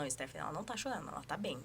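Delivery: low, soft, quick calming of herself; quick hushed self-reassurance. Retold or imitated. Mother in the shower calming herself, soft reassurance. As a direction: talk yourself down quietly.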